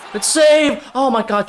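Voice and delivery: loud, high cheer; thrilled outburst at a big stop; excited shout. Real, not acted. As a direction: yell with joy at the save.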